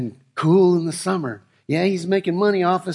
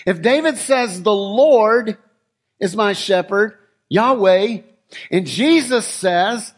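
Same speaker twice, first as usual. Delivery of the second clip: louder, higher preaching with slow, drawn-out phrases; rising preaching; 5 semitones higher, 4 dB louder than usual. Acted. Preacher stirring the congregation about King David, building fervour.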